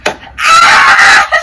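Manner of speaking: sudden loud high shriek breaking into laughter; laughing shriek. Acted. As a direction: let out a playful scream. Play fight among friends.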